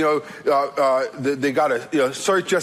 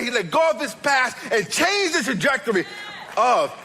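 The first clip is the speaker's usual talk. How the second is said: high-pitched, driving sermon delivery; emphatic preacher pressing the point home; impassioned preaching; 6 semitones higher than usual. Acted. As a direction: preach it with rising urgency.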